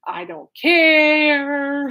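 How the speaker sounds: [name]'s sarcasm. Retold or imitated. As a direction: hold the final word in mock indifference. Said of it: "this is how Alice put it".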